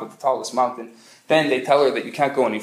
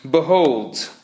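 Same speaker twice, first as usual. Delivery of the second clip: solemn, ringing prophet's Behold; a preacher's grand Behold; biblical proclamation. Shown as a demonstration. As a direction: declaim Behold like an Old Testament prophet. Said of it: "very biblical, right?".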